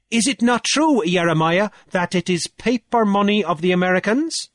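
high, loud declaiming challenge; Cornelius, triumphant orator. A narrator's reading. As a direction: orate forcefully to a whole assembly. Cornelius questions Jeremiah.

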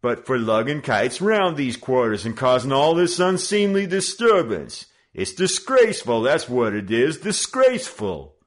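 high, loud sermonizing gang leader; mock outrage. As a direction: scold them like a pompous judge.

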